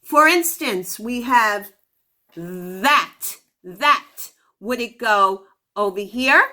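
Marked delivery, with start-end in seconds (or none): 2.4-4.1 that with a buzzing th like z; voiced th demo. Shown as a demonstration. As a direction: make the th in that hum like a z. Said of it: "We have th-, like the z sound".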